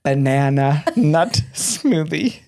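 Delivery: slow, careful banana nut smoothie. Retold or imitated. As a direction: spell it out slowly. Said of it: "I look up at it again".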